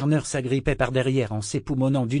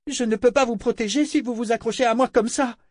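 desperate plea; 12 semitones higher than usual. A narrator's reading. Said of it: "clama-t-elle désespérément".

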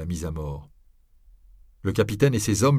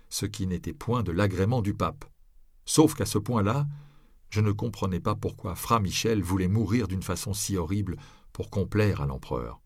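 ordinary delivery, nothing marked

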